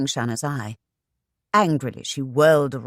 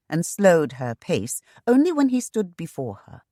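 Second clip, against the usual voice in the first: ordinary delivery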